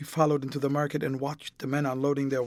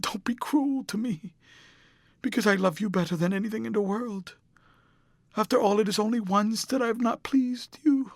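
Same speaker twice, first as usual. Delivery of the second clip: put-on much higher, tearful young woman begging not to be left; Sybil Vane pleading; 7 semitones higher, 4 dB louder than usual. A narrator's reading. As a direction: plead desperately, voice climbing high.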